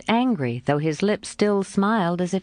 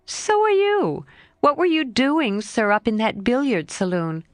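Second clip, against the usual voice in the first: lightly voiced lively girl, a bit higher; a hint of a frank young girl, pitched up; Jo's voice; 5 semitones higher than usual. Jo answering her friend Laurie, lightly voiced girl.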